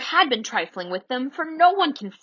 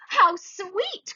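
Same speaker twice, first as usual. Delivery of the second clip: put-on high, delighted girl's cry; 9 semitones higher than usual. A narrator's reading. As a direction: gush over it like a charmed girl. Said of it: "cried Wendy".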